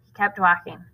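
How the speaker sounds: ordinary delivery, nothing marked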